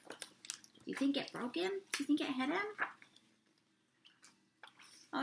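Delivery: ordinary delivery, nothing marked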